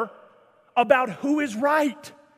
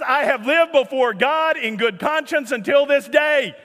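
raised, louder voice in disbelief; incredulous shouted quote; 4 semitones higher, 4 dB louder than usual. Retold or imitated. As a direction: shout the quote as if astonished anyone objected. Exasperated re-quote of the harmless statement.

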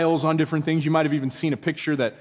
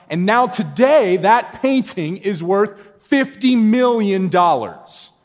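slow, louder, higher punch; preacher's slow emphatic reveal; 6 semitones higher, 6 dB louder, slower than usual. Real, not acted. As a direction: land the big number slowly. Building to the punchline.

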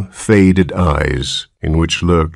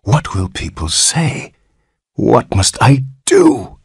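horrified murmur; 4 semitones higher than usual. Acted. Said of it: "he murmured in an ecstasy of horror".

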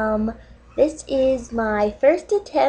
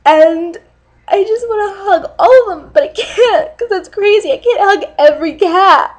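higher, louder, rushed teary blubbering; mock sobbing; 7 semitones higher, 4 dB louder, faster than usual. Fake dating video, sobbing about hugging cats. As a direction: blubber it out as if overwhelmed.

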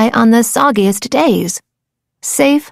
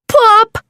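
plop sound; 10 semitones higher than usual. Acted. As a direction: pop the word out. Sound of shell dropping.

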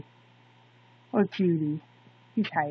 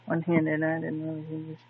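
normal talking voice

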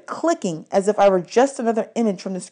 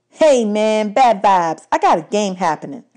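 put-on louder, irritated groovy teen; annoyed seventies kid; 3 semitones higher, 5 dB louder than usual. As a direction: shoo him off, man. Darren brushes Percy off.

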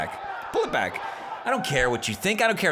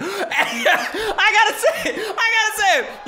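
high, laughing squeal; tickled and high-pitched; giddy laughing; 4 dB louder, slower than usual. Real, not acted. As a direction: laugh through it, barely able to hold the words in. Giddy before delivering a comeback.

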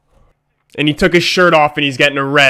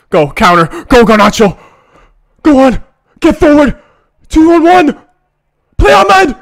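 rising higher and louder with the run; urgent building cheer; 9 semitones higher, 6 dB louder than usual. Real, not acted. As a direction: drive the counterattack forward. Urging a counterattack live.

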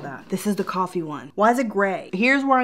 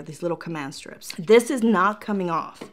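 ordinary talk, nothing marked

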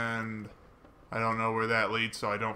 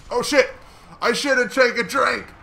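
loud, high cry of dismay; high-pitched gamer yelp; alarmed outburst; 11 semitones higher, 7 dB louder than usual. Real, not acted. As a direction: yell it in sudden panic as it goes wrong. Caught out in a fight.

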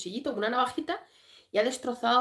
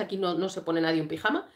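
ordinary delivery

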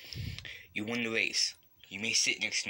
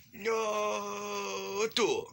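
a high, crying shout of no; anguished cry; 9 semitones higher than usual. Real, not acted. Kid losing the pig race cries out in dismay. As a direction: wail it like losing hurts.

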